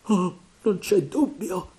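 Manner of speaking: high, slow, fearful voice; frightened stammer. A narrator's reading. Terrified pirate certain it's Flint, faltering. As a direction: falter in dread. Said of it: "balbettò uno".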